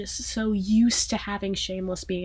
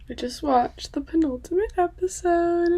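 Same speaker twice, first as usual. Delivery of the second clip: ordinary delivery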